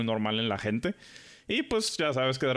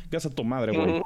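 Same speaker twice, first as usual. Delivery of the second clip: ordinary talk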